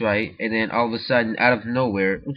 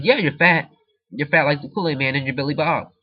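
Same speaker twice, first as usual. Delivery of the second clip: louder, higher retold taunt; imitated teasing; 5 semitones higher, 5 dB louder than usual. Friend teases speaker about Kool-Aid man in retelling, mock taunting. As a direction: throw the friend's insult back, raised voice.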